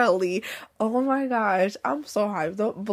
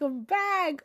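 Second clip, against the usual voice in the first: higher, bursting with joy; excited; 8 semitones higher than usual. Real, not acted. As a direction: announce your return with delight. Repeats I'm back, growing excited.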